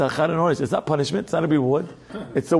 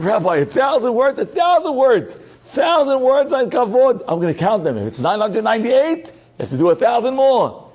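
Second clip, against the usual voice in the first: put-on higher, slowed, outraged pleading kid; indignant student; 8 semitones higher than usual. Student Yossi protesting to his rebbe, higher and indignant. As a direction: act out a boy aghast at his homework.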